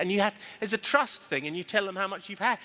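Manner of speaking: ordinary talk, nothing marked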